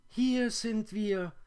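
very high, loud spider squeal; shrill calling voice, far above natural pitch; strange high voices. A narrator's reading. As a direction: answer as tiny whirring creatures in chorus. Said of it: "antworteten die fremden Stimmen".

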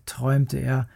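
ordinary delivery

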